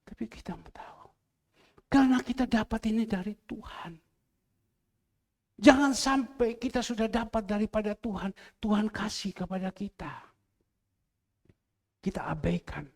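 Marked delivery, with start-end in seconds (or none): 0.1-1.1 whispered aside. Real, not acted. Preacher drops to aside. 12.1-12.8 whisper. Real, not acted. Sermon warning.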